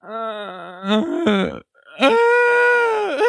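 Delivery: high, drawn-out whimpering moan; mock wailing groan. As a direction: groan upward into a thin, high whine. Acting out himself hurt on the floor.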